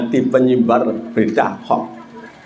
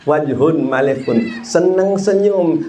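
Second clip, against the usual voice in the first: ordinary talk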